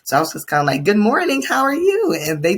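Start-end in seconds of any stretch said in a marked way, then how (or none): 0.8-2.2 high, singsong hello to a student; cheery greeting. Retold or imitated. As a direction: imitate an eager, friendly teacher's greeting. Acted friendly greeting to a student.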